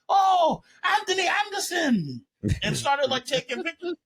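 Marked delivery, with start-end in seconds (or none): 0.1-2.2 high-pitched, star-struck squeal of recognition; mock excited tourists. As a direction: imitate tourists thrilled to spot a celebrity. Retelling tourists mistaking him for actor.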